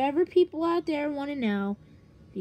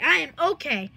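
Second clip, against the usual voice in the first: ordinary delivery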